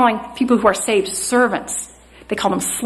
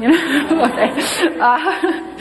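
laughing at herself, a bit higher; 5 semitones higher, much slower than usual. Real, not acted. Preacher laughs at her own chattiness, laughing. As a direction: chuckle through the words. Self-mocking joke about jabbering.

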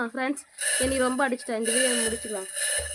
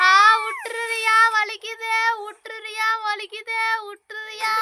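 higher, pleading cries of pain; 8 semitones higher, 3 dB louder than usual. Real, not acted. Hair pulled, crying out.

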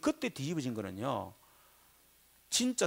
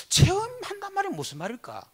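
puzzled question; 4 dB louder than usual. Real, not acted. 스스로에게 던지는 질문.

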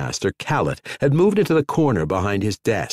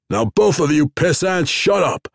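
loud rough shout, voice pushed up; army captain shouting down two rivals; gruff angry shout; 8 semitones higher, 3 dB louder than usual. A narrator's reading. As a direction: bark it over both of them.

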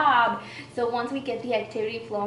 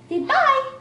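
high coaxing; 14 semitones higher, 8 dB louder than usual. Acted. Coaxing toddler to say bye.

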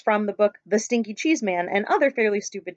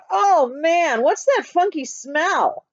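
mock-disgusted fox; 5 semitones higher, 4 dB louder, much slower than usual. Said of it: "The fox ... said".

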